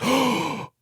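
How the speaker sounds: awed surprise after a gasp; retold gasp of surprise. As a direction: relive discovering the secret ending.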